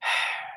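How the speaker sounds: soft unvoiced sigh; weary sigh. Real, not acted. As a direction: breathe out, worn out. Pause after venting.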